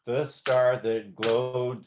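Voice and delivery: star lands on the beat; slow and sung. Shown as a demonstration. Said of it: "now on the beat".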